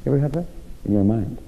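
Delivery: normal talking voice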